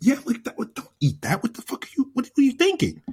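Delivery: high-pitched, exasperated scolding; retold exasperation. Husband scolds wife over gym bagels, high mock exasperation.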